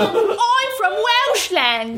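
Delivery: slow, put-on mock Welsh voice, laughing; fake Welsh accent. Teasing the Welsh guest in a silly mock Welsh accent. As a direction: ham up a bad Welsh accent.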